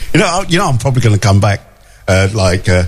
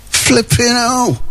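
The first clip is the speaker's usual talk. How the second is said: higher, slower mock groan of flipping hell; put-on voice; 9 semitones higher, slower than usual. Host reacting to the heat forecast, higher slow mock voice. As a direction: repeat it in a silly higher voice, dragged out.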